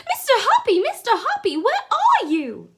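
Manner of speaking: high, loud calling out; shrill excited shout. A narrator's reading. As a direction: call out to him from below, thrilled. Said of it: "she was shouting".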